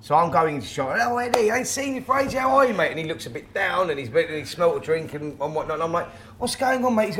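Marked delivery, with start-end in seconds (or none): 1.0-2.9 cheery greeting. Retold or imitated. Retold cheery greeting to Eddie. 6.4-7.1 friendly greeting. Retold or imitated. Retold greeting to Eddie.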